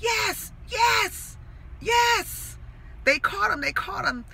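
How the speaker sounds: triumphant excitement, retold; excited. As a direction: relive the thrill of thinking they got him. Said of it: "my heart started pounding with excitement".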